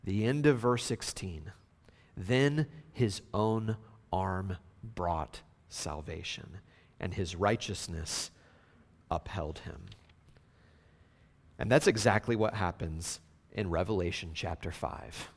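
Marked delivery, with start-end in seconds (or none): 2.2-9.8 slow, low and soft; grave, lowered voice, sinking at the end; solemn reading. Real, not acted. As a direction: read it hushed, with weighty pauses.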